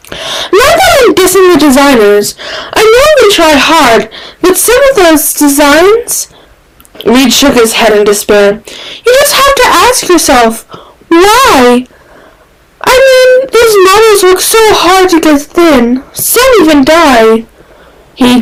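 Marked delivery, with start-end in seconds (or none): 0.1-6.3 put-on high, quick, chatty young man; a high, gossipy Reed voice; chattering Reed. Reed chattering about designers. 9.0-17.4 put-on high, quick, chatty young man; a high, gossipy Reed voice; chattering Reed. Reed lamenting thin models.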